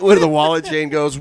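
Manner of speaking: ordinary delivery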